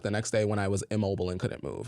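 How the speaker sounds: ordinary talk, nothing marked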